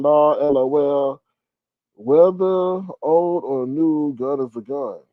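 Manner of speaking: slowly reading her comment aloud, mock-serious. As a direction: drag out the quoted comment. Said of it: "let me see what else she's saying".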